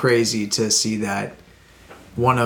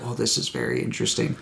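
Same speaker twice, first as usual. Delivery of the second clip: low, quiet mock musing; 10 semitones lower, 6 dB quieter, faster than usual. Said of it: "I was like".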